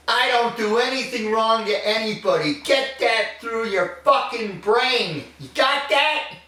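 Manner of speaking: furious, pitched high, with threat; angry. Acted. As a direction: hammer it into his head. Furious outburst at interviewer.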